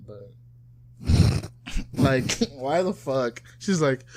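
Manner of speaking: normal talking voice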